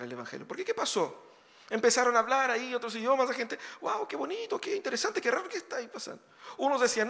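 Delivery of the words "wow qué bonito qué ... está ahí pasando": high, breathless wonder, softer; amazed crowd. Retold or imitated. Onlookers marvel at the apostles speaking in tongues, high amazed voices. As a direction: gasp it out like a dazzled onlooker.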